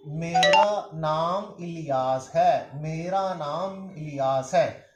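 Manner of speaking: each word drawn out and spaced; slow model answer. Shown as a demonstration. Teacher to students, modelling the answer very slowly. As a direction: spell it out slowly for learners, said three times. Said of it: "तो मैंने ये जवाब दिया था".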